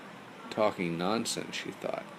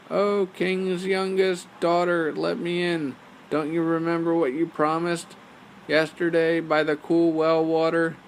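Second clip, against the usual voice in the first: frog calling at door; 8 semitones higher, 6 dB louder than usual. Acted.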